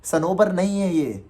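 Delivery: ordinary delivery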